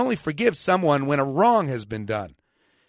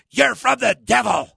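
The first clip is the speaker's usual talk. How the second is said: mock outraged accusation, higher and louder; hammed-up accuser; 7 semitones higher, 6 dB louder than usual. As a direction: play the indignant believer, over the top. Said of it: "Is that good play acting there?".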